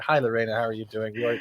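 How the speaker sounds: ordinary talk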